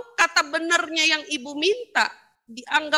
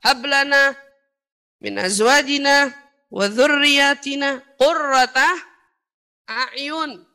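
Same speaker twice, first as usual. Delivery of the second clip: strong, slow recited Arabic prayer; Quranic recitation; 5 dB louder, slower than usual. Acted. Preacher reciting the prayer asked of God, measured recitation. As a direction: recite with long vowels, easing at the end. Makanya kita doa.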